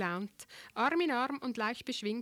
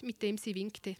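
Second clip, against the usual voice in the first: ordinary delivery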